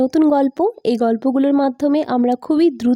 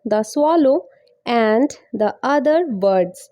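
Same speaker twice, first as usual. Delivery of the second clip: slow, word-by-word English reading; slow teaching pace; much slower than usual. Shown as a demonstration. Teacher giving learners the story's title, slowly. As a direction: read slowly for learners to copy. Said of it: "অনেক আস্তে আস্তে".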